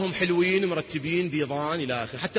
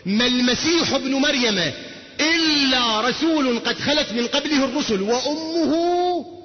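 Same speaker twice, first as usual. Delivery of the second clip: slow verse recital; 4 semitones higher, slower than usual. Acted.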